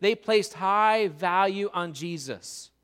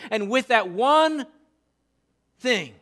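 usual speaking voice

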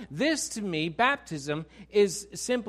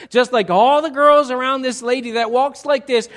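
normal talking voice

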